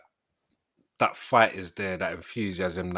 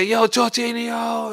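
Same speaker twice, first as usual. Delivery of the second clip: mock yell; 12 semitones higher, 4 dB louder, much slower than usual. Retold friend yelling on call.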